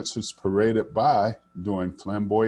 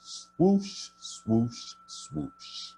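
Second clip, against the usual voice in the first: high swoosh fading to a whisper; fading wind swoosh; 5 semitones higher, 3 dB quieter, much slower than usual. A narrator's reading. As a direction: let the wind rush off into the distance. Wind sound fading in story.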